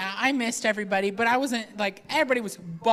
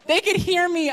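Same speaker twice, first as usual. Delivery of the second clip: ordinary talk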